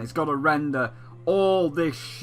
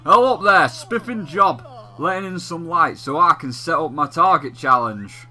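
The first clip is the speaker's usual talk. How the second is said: higher, hearty mock-Cockney gent; 4 semitones higher, 4 dB louder than usual. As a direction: greet them brightly in a rough Cockney accent. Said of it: "sound a little bit Cockney".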